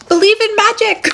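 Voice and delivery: high, loud, giddy call; excited, over-the-top high exclamation; exuberant proclamation. Acted. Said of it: "I'm hearing Grandmother Willow from Pocahontas".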